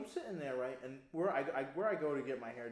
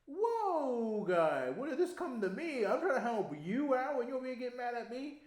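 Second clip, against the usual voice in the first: higher and louder, offended disbelief; indignant; 10 semitones higher, 5 dB louder than usual. Retold or imitated. Teller to the angry customer, higher indignant protest. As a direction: push back, shocked he's mad at you. His own retorted indignation.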